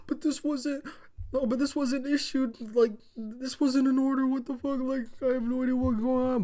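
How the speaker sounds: very high-pitched mock protest; Paul Heyman. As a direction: plead innocence in a squeaky imitation voice. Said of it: "Paul Heyman's like".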